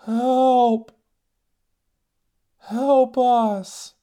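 high, loud, far-off calls for help; distant cries for help. Retold or imitated. Voices lost on the mountain crying help from afar. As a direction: call out like stranded people in the dark. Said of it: "I hear".

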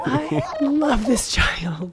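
tearful, slow and loud; choked up with joy; happy tears. Real, not acted. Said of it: "Why are you crying?".

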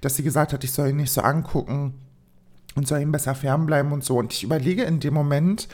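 normal talking voice

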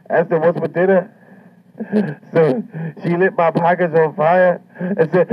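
ordinary talk

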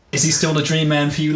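ordinary talk

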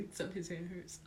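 usual speaking voice

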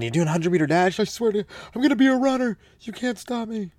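put-on high, loud defiant kid shout. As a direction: yell it at the parents like a vow.

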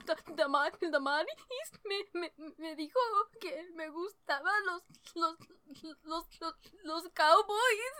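high, stammering mock sobbing; hammed-up tearful Spanish confession; imitated crying. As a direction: stutter through it like a bawling child, climbing to a wail. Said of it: "started crying even more".

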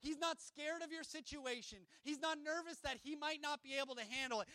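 ordinary talk, nothing marked

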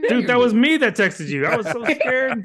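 playing along as the terrified texter, mock fear. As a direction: pretend to be the spooked scammer. Plays the scared scam texter.

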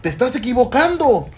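put-on high, insistent kid voice; protesting child. Little boy contradicting his dad before visitors, mock-indignant protest.

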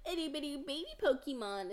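put-on high, fast cooing baby talk; annoying baby talk. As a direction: coo it like talking to a toddler. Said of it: "my voice is so annoying".

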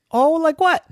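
put-on high, keen child voice; eager question. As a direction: perk up with curiosity. Dialogue between boy and stairs.

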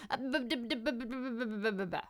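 soft stammered string of nonsense syllables; tongue-tied babbling. Retold or imitated. Shy woman meeting a guy, tongue-tied stammer. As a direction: mimic being unable to get words out. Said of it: "I couldn't talk".

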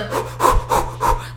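quick puffs of breath; panting. Retold or imitated. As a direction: imitate frantic panting while fleeing. Imitating herself running from the roaches.

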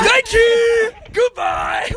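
gleeful yell before hanging up. Real, not acted. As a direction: shout it out, high and triumphant. Hangs up after the prank call.

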